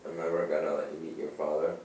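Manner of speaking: ordinary talk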